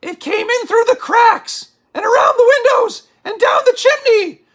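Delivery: usual speaking voice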